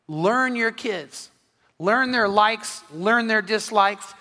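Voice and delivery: each learn drawn out slowly; slow emphatic teaching. Shown as a demonstration. Pastor stressing the key line to parents, slow and deliberate. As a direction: lean on every learn, slowly. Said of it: "this is the key line".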